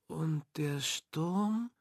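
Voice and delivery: soft, slow, feeble question; weak voice. A narrator's reading. Sick daughter asks her father about the storm, weakly. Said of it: "mit schwacher Stimme".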